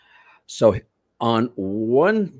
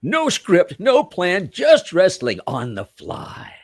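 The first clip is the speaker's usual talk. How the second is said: high, loud slogan hype, slowing at the end; promo announcer; 9 semitones higher, 4 dB louder, slower than usual. Acted. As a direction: belt out the tagline like a ring promo. Reads out event poster slogan.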